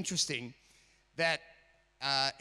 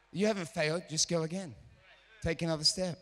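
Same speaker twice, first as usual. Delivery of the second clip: ordinary talk, nothing marked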